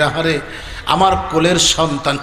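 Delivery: ordinary talk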